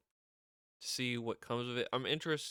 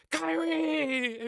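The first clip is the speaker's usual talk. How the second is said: high mock call of Kairi; playful high shout of Kairi; 7 dB louder than usual. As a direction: yell her name across the room. Imitating Asuka calling out.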